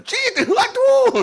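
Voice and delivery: very high, slow squeal of mock pain; a hammed-up yelp at a pinch; mock pinch and ouch. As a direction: squeak like you have just been pinched. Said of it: "tangannya nyubit".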